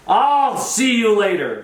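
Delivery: high, long drawn-out call; mock parting holler. Charlie dismisses his stage victim, mock parting holler. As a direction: imitate him hollering goodbye, stretching every word.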